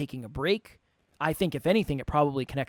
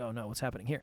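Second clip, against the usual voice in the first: quiet alarm; 6 semitones lower, 9 dB quieter, faster than usual. Retold or imitated. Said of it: "I was like".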